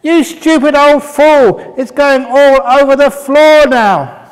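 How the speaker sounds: very high, loud and slow, hurling an insult; furious shout. Acted. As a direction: yell it at the old man, outraged. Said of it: "And the monk just smiled".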